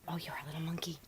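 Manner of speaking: soft fond whisper. Real, not acted. Owner whispering fondly to a puppy. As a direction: breathe it to the pup.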